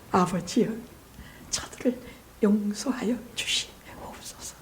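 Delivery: hushed, slow plea for forgiveness; Jesus. Acted. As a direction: pray softly to the Father, fading to near whisper. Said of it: "예수님께서 하신 첫 마디의 말씀". (낮고 조용히 용서를 비는 기도)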